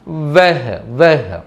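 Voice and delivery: వెహ్ as one syllable with short e; Hindi pronunciation. Shown as a demonstration. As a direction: let వెహ్ end on a light h. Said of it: "వెహ్ అని పలకాలి". (వెహ్ చివర పొల్లు హకారం)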